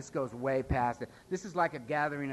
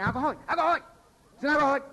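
high, loud mock excitement; excited; 12 semitones higher, 7 dB louder, much faster than usual. Newcomer at AA hearing a speaker, excited. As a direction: get hyped up about a fellow alcoholic.

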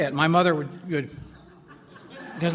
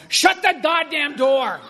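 loud, high-pitched collective yell; 10 semitones higher, 6 dB louder, slower than usual. Retold or imitated. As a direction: yell it slowly as an irritated barful of drinkers.

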